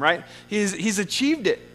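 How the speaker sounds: normal talking voice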